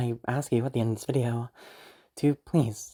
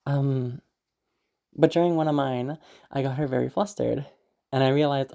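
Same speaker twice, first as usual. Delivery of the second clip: normal talking voice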